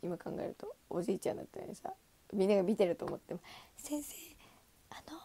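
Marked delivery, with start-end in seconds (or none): 3.8-5.2 timid whisper-like call. Retold or imitated. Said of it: "みたいな".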